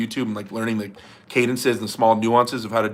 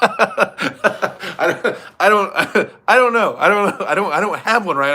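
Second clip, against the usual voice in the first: real cracking up, higher pitched; helpless giggling protest; laughing; 6 semitones higher, slower than usual. Real, not acted. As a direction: try to speak through your own laughter. Cracking up at own failed impression.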